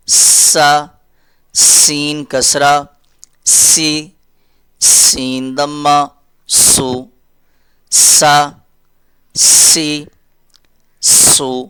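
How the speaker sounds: slow, drawn-out letter drill; slow teaching drill. Shown as a demonstration. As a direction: spell each sound out slowly for children. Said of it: "teacher drilling letter with vowel marks". (آہستہ تدریسی مشق)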